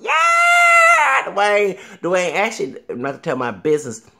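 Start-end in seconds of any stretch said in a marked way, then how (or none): 0.0-1.8 high, loud fan cheer; squealing with delight at the TV; excited cheer. Retold or imitated. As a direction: cheer him on from the couch. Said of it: "I was like".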